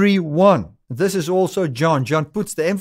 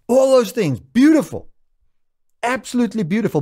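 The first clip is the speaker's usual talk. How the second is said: rapturous; 8 semitones higher than usual. Real, not acted. Preacher exclaims over scripture.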